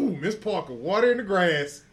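loud, drawn-out swooning holler at a crush. Real, not acted. Friend swooning loudly over an actress he admires, drawn-out holler. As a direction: call it out slow like she just walked by.

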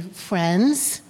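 ordinary delivery

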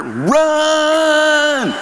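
sharp high shout of run; yelled warning. Retold or imitated. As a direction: yell at her to flee, loud and high. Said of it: "I'd be yelling at her".

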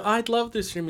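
high excited voice; mock first wife voice. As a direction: play the eager wife. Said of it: "she'd go".

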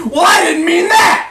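high, loud furious yell; acted enraged shout; angry outburst. A narrator's reading. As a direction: explode at them as if smashing a glass. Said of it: "smashed his whiskey glass against the wall".

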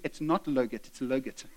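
both "logurt" words start with L, pitched low; child's mispronunciation. Retold or imitated. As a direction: correct someone while saying "logurt" with L both times. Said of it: "then they said to me".

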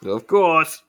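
high, loud character voice; big, high-pitched reply; Faust. Acted. Faust answering in character, loudly.

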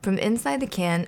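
ordinary delivery, nothing marked

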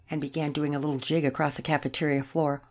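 ordinary delivery, nothing marked

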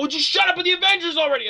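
high, exasperated yell. Retold or imitated. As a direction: snap at a friend who won't stop. The bad guys hear me yelling.

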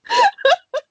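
helpless squealing laugh; real laughing fit, high and breaking; high cracking laugh. Real, not acted. Host cracking up at her own joke.

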